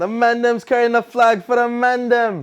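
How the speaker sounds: high loud patois chant; Jamaican patois. Pretend. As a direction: echo it in playful patois. Echoing patois bit.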